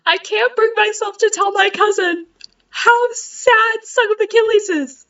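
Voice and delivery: half laughing, half pained; laughing through it. Real, not acted. Telling viewers why she won't tell her cousin, laughing. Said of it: "I'm laughing because it's funny".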